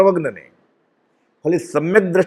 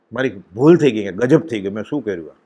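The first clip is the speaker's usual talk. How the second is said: low, softening retold regret; retold tearful remorse; 6 semitones lower, 3 dB quieter than usual. Disciple confesses his mistake to his master, retold tearful remorse. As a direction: let the imitated confession sink low and quiet. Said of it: "પોણી કલાક સુધી રડે છે પ્રભુશ્રીજી".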